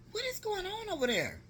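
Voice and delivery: very high-pitched incredulous question; alarmed bewilderment. Retold or imitated. Aunt watching niece run into the street, alarmed. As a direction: retell it as a frantic demand.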